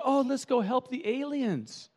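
a touch of a high, slow naive voice; lightly mocking naive heroine. Preacher mimics the heroine, mildly naive. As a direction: slowly play the clueless optimist. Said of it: "she's going".

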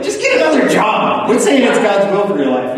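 high, laughing exasperated comeback; exasperated retort. Retold or imitated. Preacher retells his reply to the complaining friend, laughing. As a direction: laugh in disbelief and tell him to quit. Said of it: "And I'm always like".

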